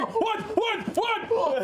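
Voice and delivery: high, frantic shouts in quick repeats; panicked shouting. Acted. Guy stabbing at the carpet, panicked high shouts. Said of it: "Going, going".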